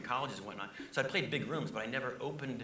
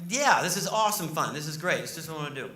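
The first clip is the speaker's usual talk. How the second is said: sarcastic; 3 semitones higher, 9 dB louder than usual. Retold or imitated. Sarcastic reply on bumpy plane.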